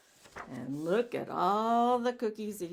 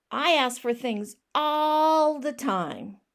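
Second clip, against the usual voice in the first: upset pigeon; 5 semitones higher, 7 dB louder, slower than usual. Acted. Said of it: "It's getting a little upset".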